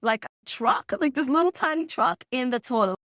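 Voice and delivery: high-pitched mock yell; imitated screaming. Yelling to a parent from downstairs, mock scream. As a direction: call out a household alarm in a high voice. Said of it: "I hear someone screaming".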